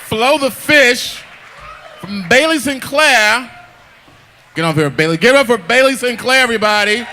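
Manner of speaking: big, high announcer voice; live show announcer. Acted. Host to audience after the story, announcing loudly. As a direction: whip up applause for the act.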